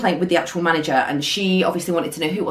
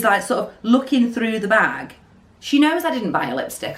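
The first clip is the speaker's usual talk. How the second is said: usual speaking voice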